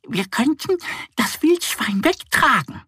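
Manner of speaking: high half-whispered duck voice; duck idea. Acted. Duck Annie proposes carrying the boar, high. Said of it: "meint Annie".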